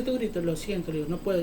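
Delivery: voz baja y grave, como excusándose; disculpa apagada. Retold or imitated. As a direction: baja mucho el volumen, disculpándote. Respuesta apenada que él mismo retoma. (disculpa bajita)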